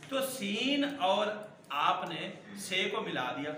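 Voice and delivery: ordinary talk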